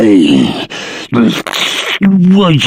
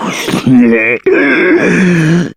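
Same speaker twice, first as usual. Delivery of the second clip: pleased troll laugh; 10 semitones higher than usual. Acted.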